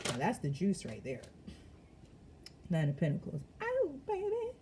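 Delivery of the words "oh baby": delighted. Real, not acted. Thrilled by the Nine of Pentacles card, high delighted cry. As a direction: squeal with delight.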